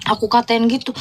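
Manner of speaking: normal talking voice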